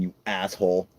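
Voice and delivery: usual speaking voice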